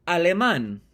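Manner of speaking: "alemán" said as Spanish, stress on the last syllable; Spanish pronunciation. Shown as a demonstration. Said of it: "In Spanish".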